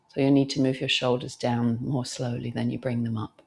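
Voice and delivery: usual speaking voice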